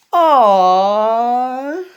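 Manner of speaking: loud, moved aww; a swelling aww of feeling; touched aw. Acted. Friends moved by the thanks, a loud aww. Touched reaction, cry heard.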